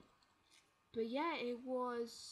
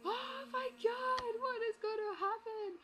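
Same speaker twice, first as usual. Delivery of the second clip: very high, slow, loud squeal of dread; mock suspense; 14 semitones higher, 4 dB louder, slower than usual. Viewer reacting to suspense in the film, mock panic. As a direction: squeal it, hammed-up and drawn out. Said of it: "There is suspense in there".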